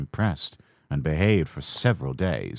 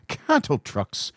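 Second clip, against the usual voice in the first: grumbling; 8 semitones higher, slower than usual. A narrator's reading. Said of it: "grumbled the coaches".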